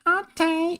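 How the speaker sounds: put-on very high little-kid falsetto; tiny high toddler voice; little child. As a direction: squeak it like a tiny obedient child. Child's answer in game, voiced.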